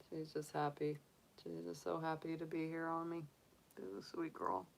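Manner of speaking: soft, hushed and tender. Real, not acted. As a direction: murmur lovingly to the bunny on your chest. Tender talk holding bunny.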